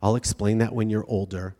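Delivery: low, soft mock-comforting dad; soothing dismissal. Dad patting daughter on the head, mock soothing. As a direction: placate a child and brush it off. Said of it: "pat her on the head and be like".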